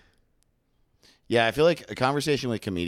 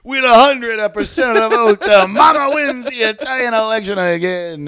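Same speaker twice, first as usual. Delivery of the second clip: mock announcer; 14 semitones higher, 11 dB louder than usual. Announcing Mama's victory, hammed-up Italian announcer. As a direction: announce the landslide like a TV anchor.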